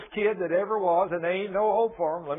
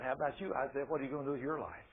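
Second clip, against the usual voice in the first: soft, low voice of God; quiet questioning; 5 semitones lower, 11 dB quieter, faster than usual. Retold or imitated. God asking Isaiah about his life, soft and low. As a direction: ask gently, well under your preaching voice.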